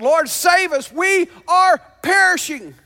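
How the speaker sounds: loud, desperate yell for rescue; a full-throated cry for help; yelled plea. Acted. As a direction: shout it as men sinking in a storm. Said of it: "They yelled out".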